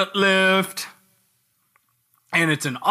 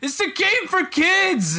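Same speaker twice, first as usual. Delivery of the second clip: exasperated; 11 semitones higher than usual. Real, not acted. Exasperated outburst about kids' game.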